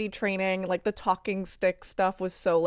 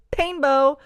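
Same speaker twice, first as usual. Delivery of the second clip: quoting Angel's delivery; 7 semitones higher, 7 dB louder than usual. Retold or imitated. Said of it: "deliver that line that way".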